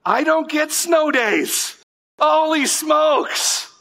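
high, slow dawning shock; the penny drops, stretched out high; sudden realization. Retold or imitated. Pastor realizing he gets no snow days, slow high disbelief.

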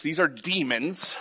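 ordinary talk

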